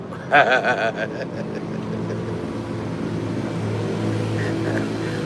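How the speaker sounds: breathless silent laugh; long wheezing laugh. Real, not acted. Laughing at his own Majin Buu joke, a breathless wheeze.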